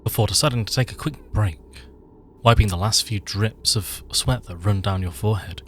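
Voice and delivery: loud dramatic scene-setting read; story narration. A narrator's reading.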